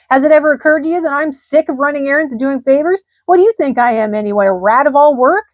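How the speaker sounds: loud indignant rat. A narrator's reading. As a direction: complain with rising irritation. Rat's indignant rant.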